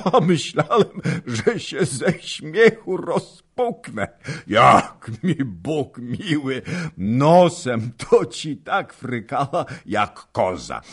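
high, laughing, slowed; laughing storyteller. A narrator's reading. Old nobleman laughing to the husband about the wife. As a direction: chuckle through the tale.

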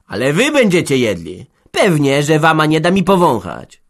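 high, loud furious tirade; fighting angry. Acted. Hanka fights back against the smith, angry and loud. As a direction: lash back with full force. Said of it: "nabrała mocy do walki".

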